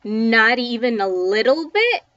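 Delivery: loud, looming pirate ghost taunt; booming ghost voice. A narrator's reading. Pirate ghost mocking the cowering kids, booming. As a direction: boom the taunt down at them. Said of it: "his voice boomed again".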